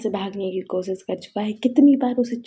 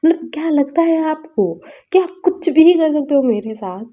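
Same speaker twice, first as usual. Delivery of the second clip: higher and louder, an indignant outburst; retold shouting; 4 semitones higher, 5 dB louder than usual. Son shouts at his parents over the forced marriage, indignant yell. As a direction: yell the protest in the parents' faces, hurt and angry. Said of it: "चिल्ला उठता है".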